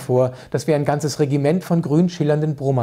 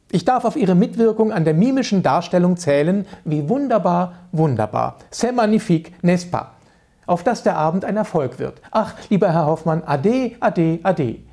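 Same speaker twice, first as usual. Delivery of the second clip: high, affected society-lady gush; gushing consul's wife; 4 semitones higher than usual. Acted. Consul's wife gushes her goodbye to Hoffmann, high and affected. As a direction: gush sweetly over the tutor, a bit higher. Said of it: "hörte ich die Frau Konsulin Mark sagen".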